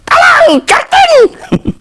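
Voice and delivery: very high squeaky parrot voice saying "Hello, Justin". Acted. The parrot puppet greets Justin in a very high squeaky voice. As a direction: squawk it like a talking parrot.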